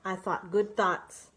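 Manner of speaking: ordinary delivery, nothing marked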